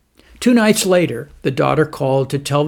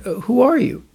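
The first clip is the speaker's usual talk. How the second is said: weak and bedridden; 7 semitones higher than usual. A narrator's reading. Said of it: "answered the old man".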